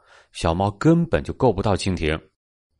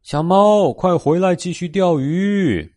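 high mother-cat call; storybook mother calling out from afar; calling out loudly; 8 semitones higher than usual. A narrator's reading. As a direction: call the little one back, pitched up high. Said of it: "大声地喊道".

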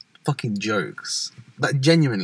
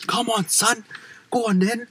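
lightly mocked football lad, pitched up; higher-pitched mock lad's goading; football hooligan; 11 semitones higher than usual. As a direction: imitate a football-factory hooligan. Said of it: "They were like".

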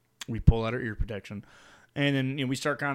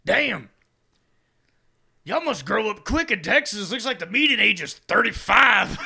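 higher, louder shout to a crowd; singer's stage banter; 7 semitones higher, 5 dB louder than usual. Retold or imitated. Singer teasing the Texas crowd, loud stage banter. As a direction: call out like a frontman working the room. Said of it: "one part where he was like".

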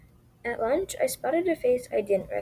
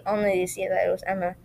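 ordinary delivery

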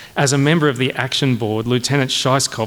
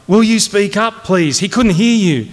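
high, louder, peremptory officer; officious demand; 8 semitones higher, 5 dB louder than usual. Acted. Officer telling a cadet the colonel couldn't hear, officiously. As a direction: echo the superior with self-important impatience.

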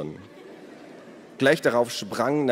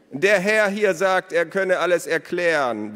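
calling out; 10 semitones higher, 6 dB louder than usual. A narrator's reading. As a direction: repeat it loudly for the boy far off. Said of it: "wiederholte Elos".

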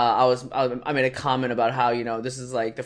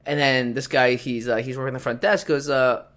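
ordinary talk

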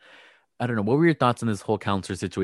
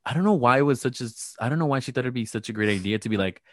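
ordinary talk

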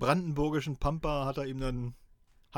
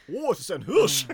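loud, high mock surprise; mock hunter exclaiming; 5 semitones higher, 11 dB louder than usual. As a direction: play the hunter spotting a deer. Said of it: "Der schlechteste Mordversuch der Welt".

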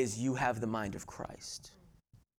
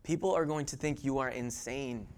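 usual speaking voice

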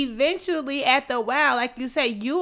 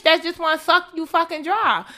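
normal talking voice

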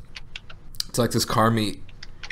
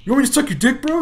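much higher, louder drunk guy imitation; mock drunk voice; 5 dB louder, faster than usual. Retelling the drunk guy's line again, very high mock voice. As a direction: squeak it like a ridiculous drunk. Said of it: "He's like".

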